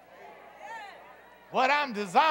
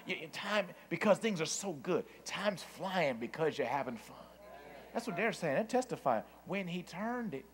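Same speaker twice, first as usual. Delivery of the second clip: low, soft preaching; hushed reflection; 4 semitones lower, 10 dB quieter than usual. Real, not acted. Preacher recalls the dreamlike turnaround, hushed. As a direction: drop down low and soft, in wonder.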